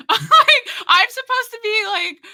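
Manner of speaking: exaggerated high squeal of complaint; high-pitched mock protest. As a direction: pitch it way up, playfully indignant.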